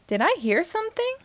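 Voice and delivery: plain reading voice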